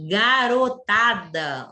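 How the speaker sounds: "garotada" said slowly as one connected word; slow, emphatic "garotada"; whole word, stretched. Shown as a demonstration. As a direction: stretch out "garotada" without breaking it apart. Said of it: "a última palavra do exemplo".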